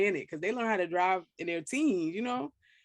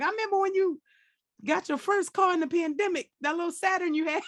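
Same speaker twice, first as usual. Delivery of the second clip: put-on teasing old friends; 9 semitones higher than usual. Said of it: "gonna be like".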